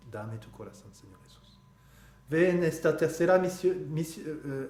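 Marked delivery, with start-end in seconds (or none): 0.1-1.6 whispered prayer. Acted. Oración íntima a Jesús.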